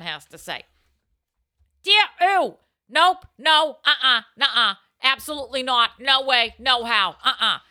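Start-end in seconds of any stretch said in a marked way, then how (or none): 1.8-7.6 loud, high string of nos; loud, high emphatic refusal. Acted. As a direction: shout down the idea with every no you have. Emphatic advice column opening.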